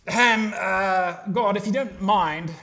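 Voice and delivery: loud, mock-polite British accent; hammed-up British politeness, stretching for effect. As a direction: put on a politely hesitant British voice. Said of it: "a British accent".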